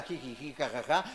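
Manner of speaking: mock giggle. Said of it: "anda tan campante en la vida".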